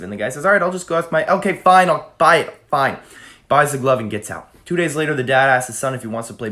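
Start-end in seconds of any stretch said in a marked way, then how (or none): 0.4-3.0 higher, louder, cutting in; exasperated giving in. Retold or imitated. Lover interrupting the blackmailing kid, exasperated. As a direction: cave in loudly before the threat finishes.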